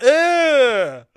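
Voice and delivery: high, loud imitated noise of rejection; super loud "ugh". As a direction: mimic her loud, drawn-out ugh. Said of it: "Like, loud".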